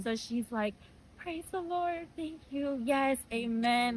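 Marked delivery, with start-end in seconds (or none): 1.2-4.0 pitched-up church-style amens; praising the Lord. Retold or imitated. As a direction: imitate a grateful mother giving praise. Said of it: "she just went into praising the Lord".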